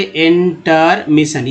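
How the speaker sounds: Intermission slowly split as in-ter-mission; syllable by syllable. Shown as a demonstration. Teacher showing learners the word parts, intermission broken up slowly. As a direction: draw out each part of intermission separately. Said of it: "ठीक है, अगर टर".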